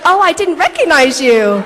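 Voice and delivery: high, loud, gushing "recognize"; gushing nurse. Retold or imitated. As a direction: play the starstruck nurse overdoing it. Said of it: "she's like".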